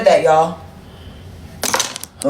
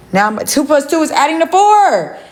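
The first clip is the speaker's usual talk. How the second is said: a higher, pointed "two plus two"; sarcastic reasoning; 7 semitones higher than usual. Real, not acted. Making her point to the viewer, pitched-up sarcasm. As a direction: lay out the obvious with sarcasm.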